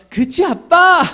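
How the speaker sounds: put-on high, loud little girl's plea; child asking dad. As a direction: ask like a trusting small girl. Daughter seeking dad's backing.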